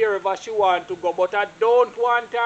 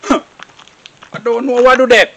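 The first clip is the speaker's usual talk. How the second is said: laughing; 10 dB louder, slower than usual. Acted. S1 laughs at the nosy worker.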